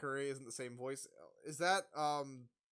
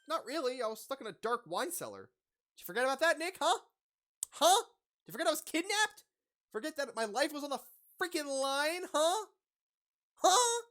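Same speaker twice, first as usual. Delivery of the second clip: much higher and louder, building to a yell; angry confrontation; 12 semitones higher, 10 dB louder than usual. Acted. Adrian furious at Nick for forgetting, angry yell. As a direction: rage rising to a shrieked huh.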